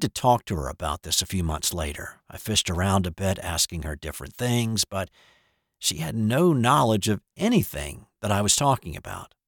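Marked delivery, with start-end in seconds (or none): none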